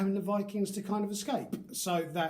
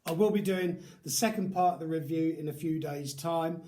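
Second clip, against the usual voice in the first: usual speaking voice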